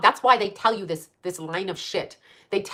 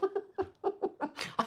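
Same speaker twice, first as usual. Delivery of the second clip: breathy high laugh; 11 semitones higher, 13 dB quieter than usual. Real, not acted. Laughing after Bravo.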